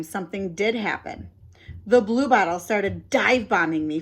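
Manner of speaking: plain reading voice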